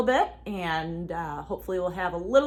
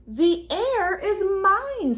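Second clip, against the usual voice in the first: higher, loud slow scream; 9 semitones higher, 6 dB louder, much slower than usual. A narrator's reading. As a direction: scream it up high, drawn out. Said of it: "screamed Lydia".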